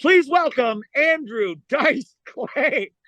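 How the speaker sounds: high, drawn-out club introduction; slow hammed-up announcer voice; stage announcer. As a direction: imitate an MC calling out the headliner. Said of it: "we hear from the other room".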